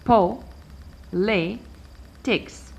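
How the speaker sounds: po, le, tix as three separate beats; divided into syllables. Shown as a demonstration. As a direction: split it up: "po", pause, "le", pause, "tix". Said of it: "I divide the word first".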